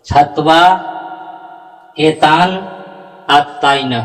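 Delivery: हत्वा एतान आतताइन word by word; correct recitation. Shown as a demonstration. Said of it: "इस तरह से आपका उच्चारण शुद्ध होगा".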